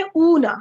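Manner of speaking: اونا announced loudly with a long "oo"; demonstrating the sound. Shown as a demonstration. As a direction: present اونا as a model sound, "oo-na". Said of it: "اگلی sound ہے".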